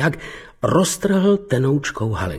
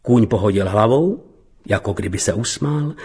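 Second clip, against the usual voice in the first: ordinary delivery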